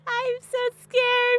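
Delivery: hammed-up whimpering fear, high and very slow; mock scared. Playing up her fear of the ghost, mock crying. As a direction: stretch it out like a fake sob.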